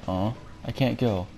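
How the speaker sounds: ordinary talk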